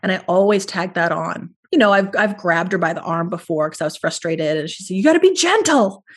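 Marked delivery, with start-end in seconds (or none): 5.0-6.0 put-on higher and a bit louder, earnest child voice; imitating a little girl. Daughter telling mommy to be gentle, high earnest child voice. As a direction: lightly voice the small daughter scolding. Daughter correcting her mother.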